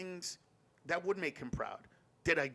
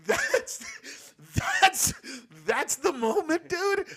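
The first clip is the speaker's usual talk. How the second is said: laughing high squeal; 14 semitones higher, 8 dB louder, much slower than usual. Real, not acted. Laughing excitedly at punchline.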